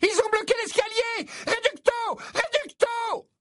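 high urgent battle cry; distant shout. A narrator's reading. Voice below casting spells, urgent high shout. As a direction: yell spells up the stairs. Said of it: "une voix cria".

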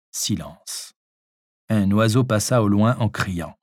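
ordinary delivery, nothing marked

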